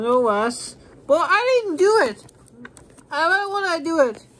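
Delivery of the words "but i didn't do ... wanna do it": high, insistent protest; protesting innocence. Pretend. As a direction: object loudly in a raised pitch. Suspect protests being put on list.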